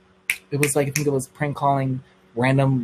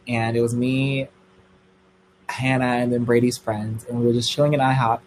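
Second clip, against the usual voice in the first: ordinary talk, nothing marked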